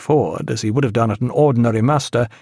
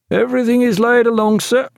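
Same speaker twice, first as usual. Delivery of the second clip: high, crisp report to the captain; raised-pitch naval report; officer reporting; 12 semitones higher, 3 dB louder than usual. A narrator's reading. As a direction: call it out high to a superior.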